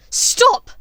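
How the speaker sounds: very high, very loud shriek. Acted. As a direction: shriek at the top of her lungs. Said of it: "shrieked at the top of her lungs".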